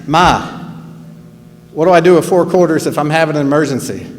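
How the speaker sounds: put-on quick, punchy New Jersey accent. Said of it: "with my New Jersey accent".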